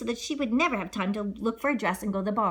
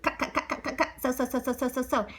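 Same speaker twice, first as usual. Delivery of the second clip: chanted sewing; 3 semitones higher, 4 dB quieter than usual. Acted. Sound of sewing.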